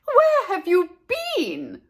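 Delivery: high, slow scolding question; scolding mother. Acted. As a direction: scold the runaway child.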